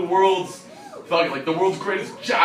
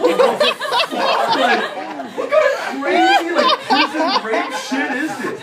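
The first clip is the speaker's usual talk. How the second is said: very high, loud comic outburst; high-pitched hammed-up disbelief; appalled shriek; 6 dB louder than usual. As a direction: shriek in mock horror at the kids. Shocked reaction to the kid's threat.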